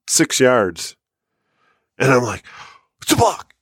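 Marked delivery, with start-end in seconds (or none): 3.0-3.5 excited. Retold or imitated. Spotting the deer, excited.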